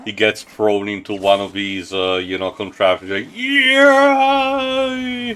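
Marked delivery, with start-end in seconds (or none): 3.3-5.3 very high goofy cheer; Ratchet's stupid yell. Retold or imitated. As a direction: squeak out a silly high yay. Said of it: "the very stupid yell that Ratchet does".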